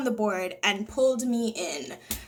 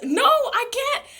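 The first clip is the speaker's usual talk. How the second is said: much higher and louder, slowed pleading; desperate no; 10 semitones higher, 3 dB louder, slower than usual. Retold or imitated. Drowning girl answering her dad, high desperate plea. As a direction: plead that you cannot make it. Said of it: "I'm like".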